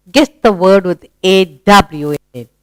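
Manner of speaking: normal talking voice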